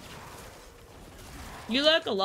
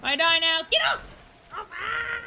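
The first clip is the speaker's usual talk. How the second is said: high, loud panicked yelps at the jumping dog; hammed-up fright as the puppy jumps on her; mock panic; 7 semitones higher, 4 dB louder than usual. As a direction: play-act being mobbed by a dog, squealing. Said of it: "Like, it's like".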